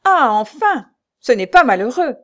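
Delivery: high, loud cry of exasperation; loud, swooping outburst of pent-up impatience; impatient exclamation. A narrator's reading. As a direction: exclaim it like a fuming grande dame. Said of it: "tempêtait de ne pas voir apparaître sa robe, s'écria".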